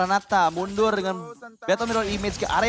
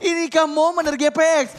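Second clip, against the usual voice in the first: hyped live play-by-play, louder; esports caster; 8 semitones higher, 5 dB louder than usual. Real, not acted. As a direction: call the teamfight with rising hype.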